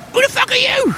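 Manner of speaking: very high squeaky goblin snarl; small goblin voice. Acted. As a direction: squeak it out like an angry little goblin. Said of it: "he goes".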